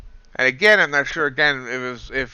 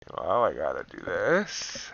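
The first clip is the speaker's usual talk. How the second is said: slow breathy whisper to himself; 4 semitones lower, 4 dB quieter than usual. Real, not acted. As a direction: say it under your breath, slowly. Quiet aside to himself.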